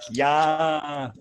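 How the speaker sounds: a light imitation of a paseño accent saying "Yeah"; paseño accent, hammed up a little; mock paseño accent. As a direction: tease it with a put-on paseño accent. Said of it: "imagine Daryl and, and me going".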